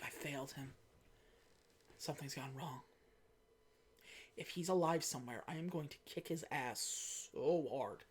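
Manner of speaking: low, hushed and spaced-out inner monologue; quietly resolute, words left hanging in long pauses; grim private musing. Retold or imitated. Boss alone in her office over her lost agent, grim and hushed.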